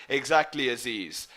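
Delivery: ordinary talk, nothing marked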